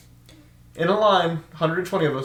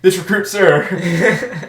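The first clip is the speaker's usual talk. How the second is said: loud, higher, drawn-out mock recruit reply; recruit answering; 4 semitones higher, 6 dB louder, much slower than usual. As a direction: sound off slowly like a recruit. Recruits answer in unison, same again.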